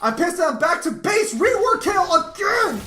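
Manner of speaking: high, loud furious yell of 'rework Kayle again'; real anger shouted high and loud; pissed-off shout. Real, not acted. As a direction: blow up at the game. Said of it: "I'm fucking pissed".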